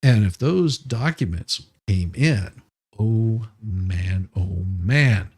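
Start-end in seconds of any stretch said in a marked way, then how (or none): none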